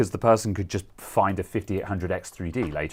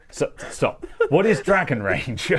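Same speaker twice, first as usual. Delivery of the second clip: giggly, louder repeat of the question; laughing; 7 semitones higher, 3 dB louder than usual. Real, not acted. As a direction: ask again while cracking up. Second take, louder, laughing.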